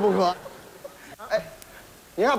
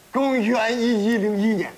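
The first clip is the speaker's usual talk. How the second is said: usual speaking voice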